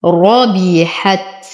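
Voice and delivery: ربحت with a heavy راء, slowly; slow tajweed demo. Shown as a demonstration. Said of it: "أفخم الراء لأنها مفتوحة". (تفخيم الراء في ربحت)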